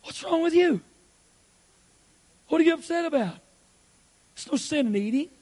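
acted exasperated husband; indignant husband. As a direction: play the puzzled husband defending lunch. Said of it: "look at her and say".

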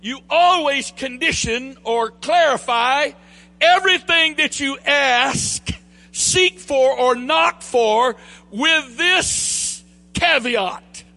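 high, loud, fervent pulpit delivery; rising sermon cadence, shouting the key lines; full-force preaching. Acted. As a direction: preach it out to the congregation with fire. Preaches at full force, raised pitch.

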